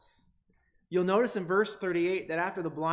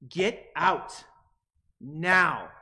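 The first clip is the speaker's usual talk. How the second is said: louder, forceful command; a crowd telling a beggar to leave, angrily; angry; 8 dB louder, much slower than usual. Retold or imitated. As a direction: throw him out with the last word. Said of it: "to get out the emotional impact".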